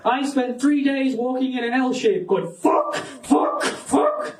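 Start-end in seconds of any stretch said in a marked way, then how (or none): 2.5-4.4 acted panic. Acting out being lost for days.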